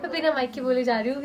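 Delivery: ordinary talk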